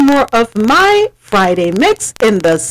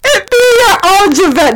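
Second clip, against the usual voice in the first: emphatic raised voice; 8 semitones higher than usual. Real, not acted. Repeating the point emphatically.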